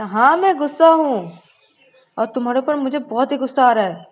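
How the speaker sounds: loud high furious yell; angry shout. Acted. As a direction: shout it out in anger. Shreya admits she is angry. (ज़ोर से गुस्से में चिल्लाना)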